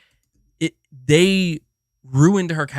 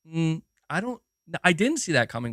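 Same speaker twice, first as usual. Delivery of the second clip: ordinary talk, nothing marked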